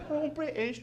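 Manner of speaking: high, soft mumbled mock British; exaggerated British accent, quiet and high. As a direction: garble it like a cartoon Brit. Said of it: "I made fun of a British guy".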